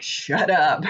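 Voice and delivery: laughing through it; laughing shut up. Retold or imitated. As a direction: giggle the words out. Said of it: "My husband was like".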